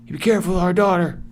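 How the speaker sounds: high, rushed mock parental warning; worried parents' warning. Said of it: "The father and the mother worried. So they tell".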